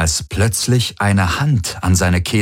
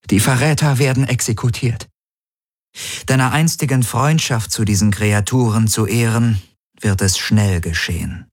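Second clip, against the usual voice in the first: lightly voiced higher woman's voice, decided; resolute Ylana; 5 semitones higher than usual. A narrator's reading. Ylana tells Galen of the executions, resolute. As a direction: announce the decision firmly in a higher voice. Ylana speaks over the comm.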